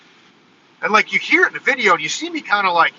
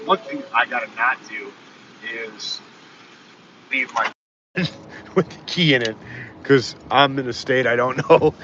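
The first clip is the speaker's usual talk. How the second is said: ordinary delivery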